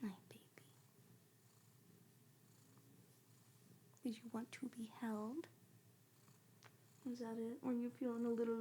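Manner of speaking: whispered pet talk; whisper to cat. Real, not acted. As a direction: whisper sweetly to the kitty.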